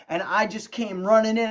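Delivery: ordinary talk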